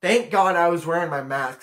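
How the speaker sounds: usual speaking voice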